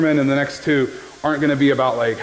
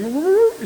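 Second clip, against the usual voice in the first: high yipping woo woo woo, much higher; dog's laugh imitation. Preacher imitating the Duck Hunt dog's laugh. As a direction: mimic the video game dog's laugh. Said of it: "and he's like".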